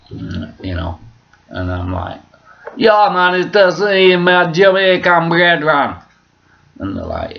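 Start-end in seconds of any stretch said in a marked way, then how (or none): 2.8-6.0 put-on loud, high Jamaican accent; hammed-up Rastafarian, pitched up and proclaiming; Rasta voice. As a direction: call out to your brethren in mock Jamaican patois. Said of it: "that's from my Rasta voice".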